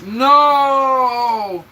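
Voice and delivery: long, high, loud wail of disbelief; shocked no. Real, not acted. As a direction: draw it out in loud dismay. Reacts to friend's harassment news.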